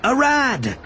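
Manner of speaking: high, falling shout of a name; urgent cry. A narrator's reading. Obi-Wan calling Arad as the klaxon sounds, urgent cry.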